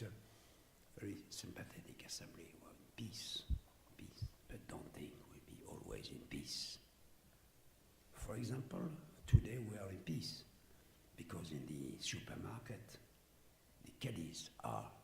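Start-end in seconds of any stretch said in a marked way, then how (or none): 1.0-6.8 hushed conspiratorial whisper; softly whispered aside. Real, not acted. 11.2-14.9 hushed whisper; whispered aside. Real, not acted.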